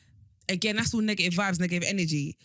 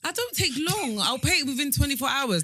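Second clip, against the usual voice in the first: pitched way up in protest; high indignant protest; 8 semitones higher than usual. Real, not acted. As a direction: squeak out the defence.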